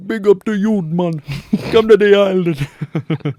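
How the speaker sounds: big cheery fake Jamaican invitation; mock Jamaican accent. Joking texts signed "mon", loud mock Jamaican accent. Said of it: "it's Jamaican".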